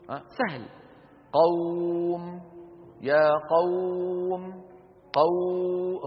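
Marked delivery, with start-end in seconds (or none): 1.3-4.6 قوم stretched very slowly, flowing; drawn-out easy glide. Shown as a demonstration. Teacher shows how easily the sound flows, slow. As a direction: glide gently through the و in قوم. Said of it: "الصوت عم يخرج بسهولة".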